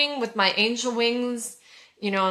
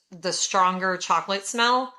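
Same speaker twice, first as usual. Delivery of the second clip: ordinary talk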